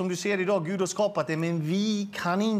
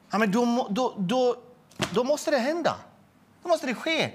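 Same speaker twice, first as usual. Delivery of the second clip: much higher, pressing voice, gaining intensity; rising urgency; 7 semitones higher than usual. Real, not acted. Telling a listener how it had to happen, urgently higher. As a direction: push it up as the moment grips you. Urgent retelling of desperate moment.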